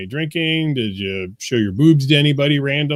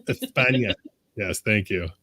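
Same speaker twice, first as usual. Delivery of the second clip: "España" with a Spanish ñ, es-pan-ya; Spanish pronunciation; 5 semitones lower, 5 dB quieter than usual. Shown as a demonstration. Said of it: "from España".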